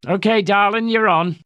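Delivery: high, breezy cabbie voice; cheerful London cab driver; cheery young cabby. A narrator's reading. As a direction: grin through it like a chirpy driver. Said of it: "said with a cheery grin".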